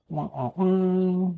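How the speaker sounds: drawn-out, high "wah, wah, wah"; mock sad trombone. Gamer mocking the moment, mock sad trombone.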